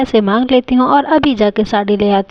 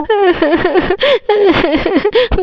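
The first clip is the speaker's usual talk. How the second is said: high staccato fake sobs; mock sobbing; 8 semitones higher than usual. Scolded wife crying over a saree, acted sobs. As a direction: sob in theatrical bursts. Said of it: "mummy कितना ज़्यादा रो रही है".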